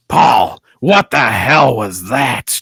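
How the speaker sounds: loud, exasperated outburst down the phone; frustrated Vince. Acted. Imagined boss complaining to Paul over the phone, frustrated. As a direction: imitate an irate boss, dragging out the complaint. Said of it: "He's just getting frustrated".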